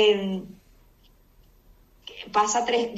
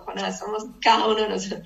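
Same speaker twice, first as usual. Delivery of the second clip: ordinary delivery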